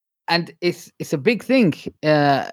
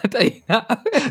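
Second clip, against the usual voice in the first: laughing; 6 semitones higher, slower than usual. Real, not acted. Laughing jab at surrender remark.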